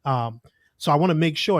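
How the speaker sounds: usual speaking voice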